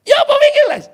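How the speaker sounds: high, loud mock call across the schoolyard; friend calling out. Schoolmate shouts at the boy about yesterday's lesson, loud and high.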